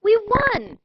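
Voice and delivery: high, loud victory yell; yelled in triumph. Acted. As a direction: shout the win with joy. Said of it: "shouted the boys".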